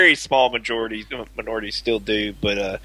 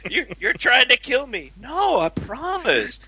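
much higher pleading Mark Wahlberg voice; Mark Wahlberg impression; 10 semitones higher than usual. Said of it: "greatest Mark Wahlberg line readings ever".

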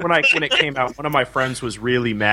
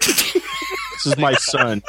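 slow, much higher mock proud-father voice; much slower than usual. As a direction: present the son with hammed-up pride.

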